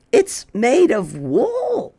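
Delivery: put-on wondering child noticing; a hint of a child. Child at the tree, slow and wondering.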